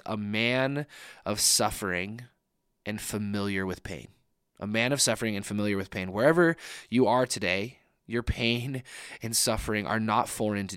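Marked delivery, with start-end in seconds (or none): 0.0-4.1 scripture read slowly, each word given weight; slow, weighty reading. Shown as a demonstration. Pastor quoting Isaiah to his congregation, slow and deliberate. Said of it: "the phrase that really stands out to me". 4.6-6.1 faster, plain restatement of the phrase; quick plain repeat. Shown as a demonstration. Pastor repeating the Isaiah line to listeners, quick and plain. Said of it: "the phrase that really stands out to me".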